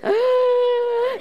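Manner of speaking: sharp high gasp of horror; shocked gasp. Retold or imitated. Cup lands on a stranger.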